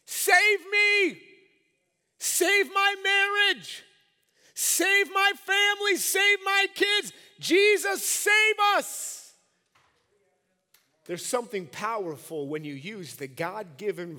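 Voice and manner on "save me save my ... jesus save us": very high, slow full-force shouts; urgent shouted prayer for rescue; yelling out. Acted. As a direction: yell each plea out at the top of your voice. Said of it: "just to yell out and use".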